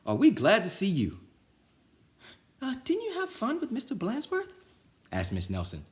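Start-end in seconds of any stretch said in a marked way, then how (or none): 0.0-1.2 loud, high happy relief; relieved kids. Acted. 2.6-4.5 very high, sweet innocent voice; sweet teacher playing innocent. Nelson teasing.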